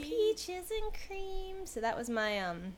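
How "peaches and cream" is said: savoring. Acted. Echoing friend's phrase, savoring.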